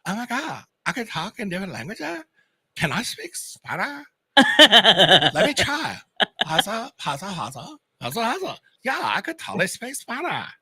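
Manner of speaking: hammed-up man amazed he can speak languages; excited coma patient. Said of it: "And he's like".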